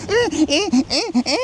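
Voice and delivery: high-pitched in-out harmonica honks; squeaky "eh" on each breath; imitated harmonica wheezing. Shown as a demonstration. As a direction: mimic a child breathing through a harmonica. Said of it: "you just breathe through it".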